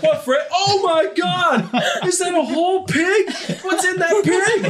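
high, loud, horrified exclaiming; hammed-up shriek of disbelief, drawn out; shocked worker. Ted sees whole pig in kitchen, shocked high yell. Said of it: "And he's like".

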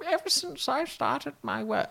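mock a clipped, cut-glass posh accent, pitched high; absurdly high, clipped posh voice; clipped posh impression. Mocking a duchess's elocution-lesson speech, clipped posh accent. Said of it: "She sounds so clipped and cut".

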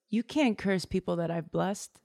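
a lightly voiced angel setting him straight; stern angel. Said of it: "And he goes".

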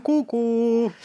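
high, loud chirpy greeting; squeaky sing-song hello. Acted.

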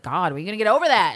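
a loud, impatient, hurried mock outburst; really loud. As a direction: blurt it out loud to shut him up. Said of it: "she says it really loud".

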